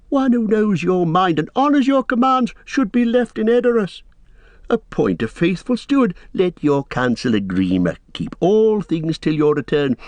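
high, servile persuasion; wheedling counselor. A narrator's reading. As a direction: plead your own case to the lord. Narrator voices scheming counselor.

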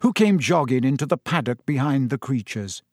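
ordinary delivery

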